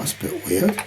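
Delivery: ordinary talk, nothing marked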